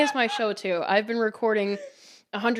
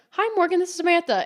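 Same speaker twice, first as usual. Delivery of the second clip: higher voiced chirpy phone-order hello; regular customer's greeting; 8 semitones higher, faster than usual. Customer ordering by name from Morgan, higher imitated greeting. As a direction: lightly imitate a customer saying hi.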